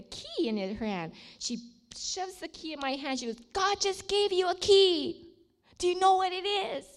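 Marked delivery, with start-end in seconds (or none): 3.5-6.8 urgent revelation. Retold or imitated. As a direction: imitate an overwhelmed friend announcing big news. Acting friend's urgent, emotional revelation.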